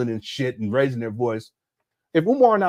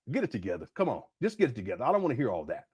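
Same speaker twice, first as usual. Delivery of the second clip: low and quiet, never raised; calm, no yelling; 4 semitones lower, 9 dB quieter, faster than usual. Retold or imitated. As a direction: settle him down without raising your voice. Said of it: "I don't yell or nothing like that".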